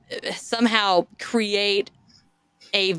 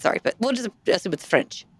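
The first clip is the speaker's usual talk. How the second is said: ordinary delivery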